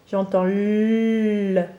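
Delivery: "L" held very slow and drawn out; sounding out. Shown as a demonstration. Teacher slowly sounding out "L" for a pupil. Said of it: "fait par la lettre L".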